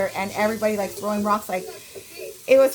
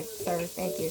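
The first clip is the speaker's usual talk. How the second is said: softer, deflated polite thanks; meek politeness; 4 semitones lower, 8 dB quieter, slower than usual. Retold or imitated. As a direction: mumble thanks to dad's friend. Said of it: "I was like".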